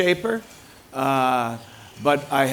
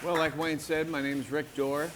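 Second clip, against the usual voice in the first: soft, under-projected introduction; too quiet; 10 dB quieter than usual. Real, not acted. Speaker introducing himself to the audience, too quietly. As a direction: introduce yourself too softly to carry. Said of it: "Speak up, Rick".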